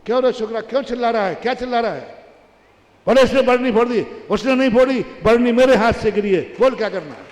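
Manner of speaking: a mother snapping at her son; scolding old mother. Acted. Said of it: "तो माँ कहती है".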